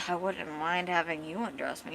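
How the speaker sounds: low, soft mumble; under her breath. Retold or imitated. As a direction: say it half to yourself. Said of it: "And under her breath".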